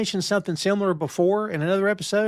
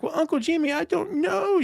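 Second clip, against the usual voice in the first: put-on much higher, sweet little-girl voice; five-year-old kid; 11 semitones higher than usual. Sister charming Uncle Jimmy, turning into a five-year-old kid. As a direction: go all innocent like a small child. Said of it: "suddenly turns into a five-year-old kid".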